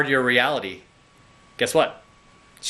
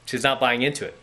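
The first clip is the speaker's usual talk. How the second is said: ordinary talk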